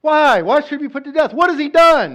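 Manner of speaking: high, loud, pleading cry of why; desperate protest. Acted. A son crying out against his father at the king's table.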